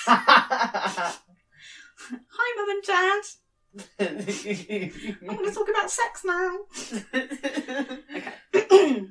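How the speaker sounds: laughing. Real, not acted. Embarrassed laughing aside to parents.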